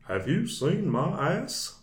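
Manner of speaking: high, slow Hank Hill-style drawl; a Texan farmer twang; Farmer Terry voice. Retold or imitated. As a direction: play the dim farmer asking for his donkey. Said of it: "that's the way I did the voice".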